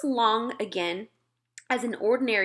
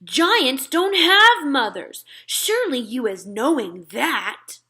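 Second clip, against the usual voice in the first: loud, higher, slow disbelief; incredulous giant; 4 semitones higher, 6 dB louder, slower than usual. Acted. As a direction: scoff at the silly human.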